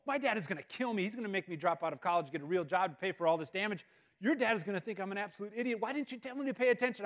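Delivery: high, fast panicked babbling; panicking. Retold or imitated. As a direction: spiral into frantic panic over the dented car. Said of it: "I'm panicking at this point".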